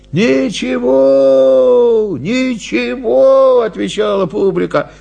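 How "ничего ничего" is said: high, long-drawn crowd voice; crowd's reassurance. Acted. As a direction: stretch it out high, waving it off. Crowd answers, waving it off. (протяжно, успокаивающе, голосом из толпы)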